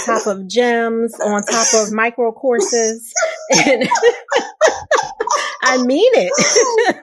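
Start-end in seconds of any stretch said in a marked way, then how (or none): 3.2-6.9 real laughter breaking through praise; laughing. Real, not acted. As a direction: laugh it out, then insist through the laugh. Host bursts out laughing.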